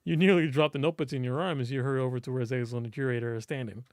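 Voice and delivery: usual speaking voice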